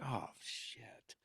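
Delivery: a hushed, unvoiced oh shit; whispered curse. Wolf hearing the singer is still sick, whispered dismay. Wolf reacting to bad news.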